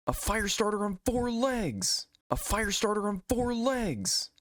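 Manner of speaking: high, slow silly introduction; cartoon announcer. Pokemon introducing itself in the recap, high slow silly voice. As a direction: announce yourself like a cartoon creature.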